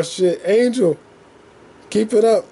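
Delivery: usual speaking voice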